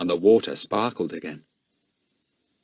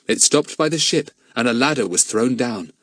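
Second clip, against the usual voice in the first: usual speaking voice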